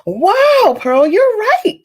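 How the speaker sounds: high, loud amazed wow; exclaimed. A narrator's reading. Ella praising Pearl for knowing quilting, loud high exclamation. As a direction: gasp it out in delight. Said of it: "Ella exclaimed".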